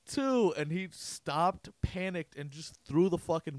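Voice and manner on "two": high sing-song countdown call; teasing countdown call from the stands; mock countdown. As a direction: call out the seconds high to trick him. Counting down to fool kid.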